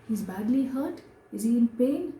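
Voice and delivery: a mother's rising alarm. A narrator's reading. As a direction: press him anxiously for news.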